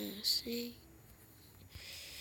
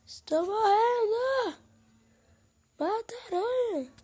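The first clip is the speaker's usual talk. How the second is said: mock crying; 9 semitones higher, 6 dB louder than usual. Spider attack in game.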